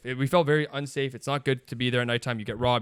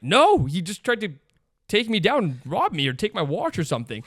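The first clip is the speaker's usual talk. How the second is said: retold indignant protest; 4 semitones higher, 5 dB louder than usual. Retold protest after being jumped.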